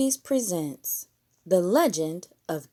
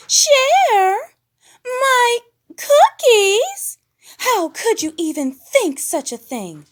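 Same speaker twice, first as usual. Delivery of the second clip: very high, loud, slowed outrage; scandalised diva, high and loud; shrieking high indignation; huffing Piggy; 12 dB louder, slower than usual. A narrator's reading. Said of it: "huffed Miss Piggy".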